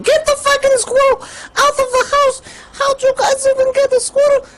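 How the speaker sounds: high screechy angry mom; shrill mom yell. Retold or imitated. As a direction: screech at them like a furious mom. Angry mom voiced in story.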